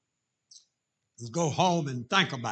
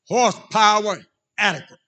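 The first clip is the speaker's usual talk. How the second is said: punchy, louder two-word declaration; loud telegram read; 4 semitones higher, 7 dB louder than usual. Acted. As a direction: announce it like a telegram. Reads out the telegram.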